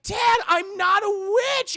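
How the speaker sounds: high, loud wailing protest; mock desperate plea. Anna pleads with dad she's no witch, wailing. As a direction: cry out innocence to a parent.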